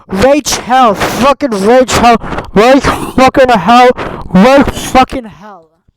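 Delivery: very loud, slow, furious repeats; enraged yelling. Real, not acted. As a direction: hammer the name again and again at full volume.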